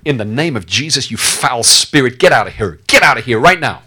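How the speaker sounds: rising thunderous command; commanding shout. Retold or imitated. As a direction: cast out the spirit at full voice. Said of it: "at the top of your voice".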